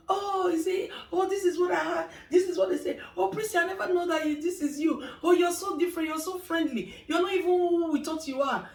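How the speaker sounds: gushing client surprised to meet her. Retold or imitated. Clients voiced gossiping about her.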